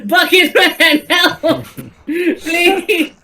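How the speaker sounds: high, laughing plea for help; panicked cry for help. Retold or imitated. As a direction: squeal for help while laughing. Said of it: "run up to Elias and be like".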